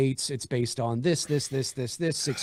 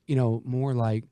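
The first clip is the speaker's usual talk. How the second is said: ordinary talk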